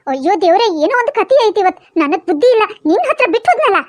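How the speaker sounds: put-on low old-woman voice, fed up; exasperated grandma. Grandma scolding Appu for leaving the baby, exasperated. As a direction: grumble like a grandmother at a naughty boy.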